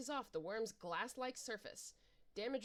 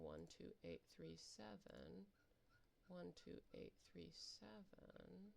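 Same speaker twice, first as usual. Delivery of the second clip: one two eight three seven repeated lower, fading to a near whisper; fading echo; 3 semitones lower, 16 dB quieter than usual. Shown as a demonstration. Player repeats the code to themself, fading to a near whisper. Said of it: "J B E one two eight three seven".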